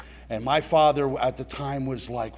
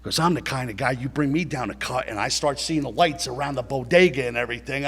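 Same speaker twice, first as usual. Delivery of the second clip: normal talking voice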